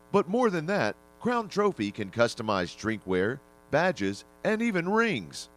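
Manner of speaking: upbeat radio commercial voice; ad read. Acted. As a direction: pitch it like a radio spot. Sponsor spot for trophy shop.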